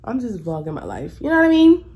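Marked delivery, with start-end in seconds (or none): 1.2-1.8 upbeat outro. Real, not acted. Upbeat vlog sign-off.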